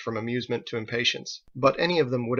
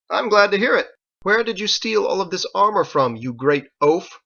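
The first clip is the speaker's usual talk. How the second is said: higher, louder, proud mockery; loud haughty taunt; 8 semitones higher, 6 dB louder than usual. Knight taunting the gang leader, loud haughty voice. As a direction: sneer down at the oaf.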